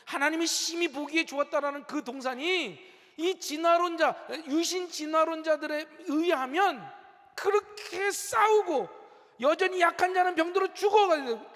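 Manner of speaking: impassioned preacher, pitched high and pressing; forceful preaching. Real, not acted. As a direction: drive the point home to the congregation.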